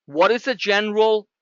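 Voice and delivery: ordinary talk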